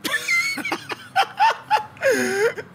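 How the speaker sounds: real high-pitched laugh, breaking up; cracking up for real at something funny; squealing laugh that bursts out; high burst of laughter. Real, not acted. Players crack up at the chat.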